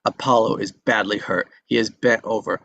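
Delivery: ordinary talk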